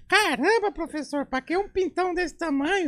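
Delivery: very high squeaky schoolkid; high, thin child's voice; thin-voiced little boy. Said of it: "O molequinho na escola falava fino".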